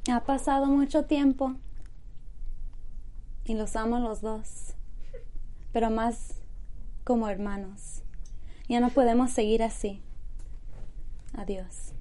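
put-on soft, sincere Mexican telenovela accent. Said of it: "You sounded so Mexican. Like a Mexican telenovela".

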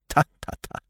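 whispery, brusque "ta, ta, ta"; impatient tutting. Acted. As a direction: cut them off with impatient clicks of syllables. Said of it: "said Poirot impatiently".

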